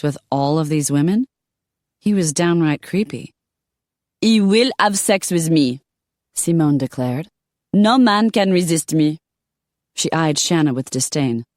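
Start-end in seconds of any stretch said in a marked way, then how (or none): 4.2-5.8 put-on higher, louder commanding French accent; a Parisian lady laying claim. Simone declares, French. 7.7-9.2 put-on higher, louder commanding French accent; a Parisian lady laying claim. Simone declares.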